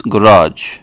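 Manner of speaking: garage stressed at the end, guh-RAHZH; American pronunciation. Shown as a demonstration. Teaching the US way of saying garage, American pron. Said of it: "Americans will call this".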